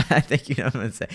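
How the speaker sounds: quick line through a laugh; laughing while teasing. Real, not acted. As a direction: giggle it out teasingly. Same line repeated, then laughing.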